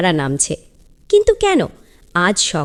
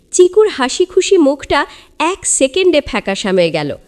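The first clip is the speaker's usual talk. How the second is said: ordinary delivery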